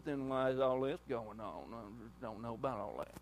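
a hint of a sulky doubter; whiny griping. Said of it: "the first thing you wanna do to me is gripe".

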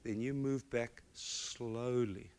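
mother holding her son's face, speaking gently; soft, earnest mother. Retold or imitated. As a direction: speak gently to a small child. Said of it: "she said".

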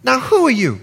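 high-pitched Bahamian grandmother; Bahamian accent. Retold or imitated. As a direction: imitate an old Bahamian granny, pitched high. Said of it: "She's Bahamian, excuse the accent".

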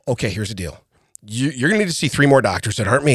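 low, subdued mock-doctor voice; doctor giving bad news. Doctor breaking bad news to patient, mock-grave and low. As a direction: drop low and soft, imitating a grave doctor. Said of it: "you're not giving me bad news like".

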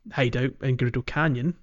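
ordinary talk, nothing marked

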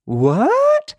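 high, loud "What?"; mock shock. Host feigning shock at his own confession, high and loud. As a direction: act scandalised.

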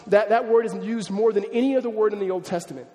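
ordinary delivery

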